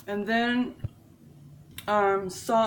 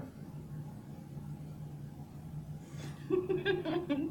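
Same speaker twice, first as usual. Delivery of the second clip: silent giggle; 13 semitones higher, 5 dB quieter than usual. Real, not acted. Laughing at basil in teeth.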